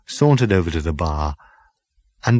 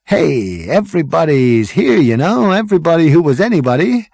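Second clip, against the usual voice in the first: excited Zaphod; 8 semitones higher, 4 dB louder than usual. Acted. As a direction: gush like a dazzled party boy. Zaphod character voice.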